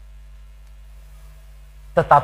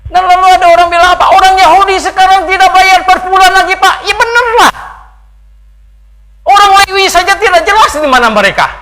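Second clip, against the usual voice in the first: high, loud, hurried sermon delivery; full-force preaching; 11 dB louder than usual. Acted. Preacher throws an objector's claim at the congregation, full-force preaching. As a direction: preach it at the top of the voice.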